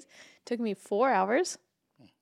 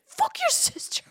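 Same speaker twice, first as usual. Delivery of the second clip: pissed escalating. Retold or imitated. Girlfriend complaining to the brother, mock shrieked rage. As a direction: repeat it, climbing into a shriek. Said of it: "All pissed".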